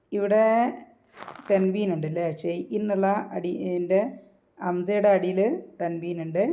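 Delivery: ordinary talk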